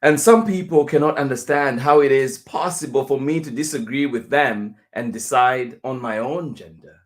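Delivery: usual speaking voice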